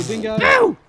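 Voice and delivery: high howling dingo call; high, loud dingo howl. Shown as a demonstration. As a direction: howl it out like a wild dog. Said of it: "do five short calls and one big long one".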